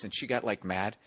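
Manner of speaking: ordinary talk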